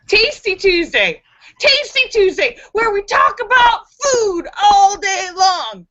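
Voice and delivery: high-pitched, excited show intro; excited announcement. Real, not acted. Host announcing the food theme day, excited.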